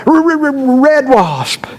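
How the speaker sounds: high-pitched stuttering on the R; mock panic, stammered high; panicked stammer. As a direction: imitate a frantic boy struggling to get the words out. Panicked boy stammers out answer.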